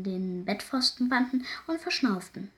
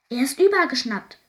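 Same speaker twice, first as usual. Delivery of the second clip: loud, raised-pitch agitated cry; aufgeregter Ausruf; 4 semitones higher, 8 dB louder than usual. A narrator's reading. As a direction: burst out with it, agitated. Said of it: "rief er aufgeregt". (aufgeregt und laut gerufen)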